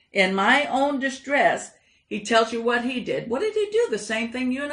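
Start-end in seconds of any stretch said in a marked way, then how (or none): 3.3-3.9 rhetorical pitch jump. Real, not acted. Rhetorical question to the congregation.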